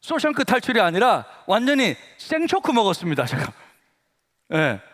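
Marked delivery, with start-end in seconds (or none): none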